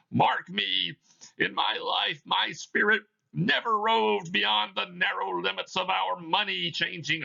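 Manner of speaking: a chain-bound spirit intoning its doom; Marley's ghost. Acted. Reads Marley's ghost in the play.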